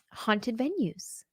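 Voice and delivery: ordinary talk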